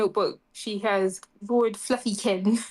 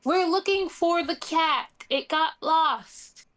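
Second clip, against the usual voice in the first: half-voiced shout in a raised pitch; yelling back; 7 semitones higher, slower than usual. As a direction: call back high, stretching the last words. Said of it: "she will then yell back".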